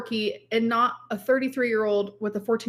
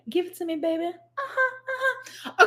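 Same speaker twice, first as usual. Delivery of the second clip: higher, breathy-soft teasing purr; mock seductive coo, softer; sultry phone sex voice; 7 semitones higher, 4 dB quieter than usual.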